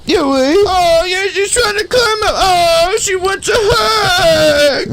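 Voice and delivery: very high squealing commentary; high, slow thrilled cries; excited squeal. Real, not acted. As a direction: squeal with delight. Excited about character climbing.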